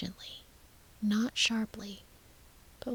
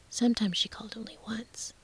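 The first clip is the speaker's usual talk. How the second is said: ordinary delivery, nothing marked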